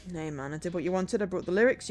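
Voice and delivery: ordinary talk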